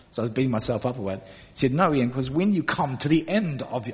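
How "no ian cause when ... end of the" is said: lively imitation of a German accent. Friend Sven advising Ian, in a German accent. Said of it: "I'll stop talking in German".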